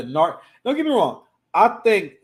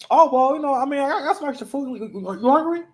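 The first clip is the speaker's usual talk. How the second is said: high-pitched, hesitant mock coworker voice; coworker's offer; 11 semitones higher, faster than usual. Coworker offering spare food to someone without lunch, high tentative voice.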